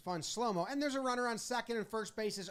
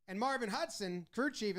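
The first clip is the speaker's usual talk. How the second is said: normal talking voice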